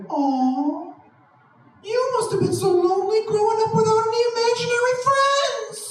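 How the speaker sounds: very high sing-song mockery; mock pity voice. Comic answering the pitiers, mocking sing-song pity. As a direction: throw the pity voice right back at them. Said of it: "I'm like".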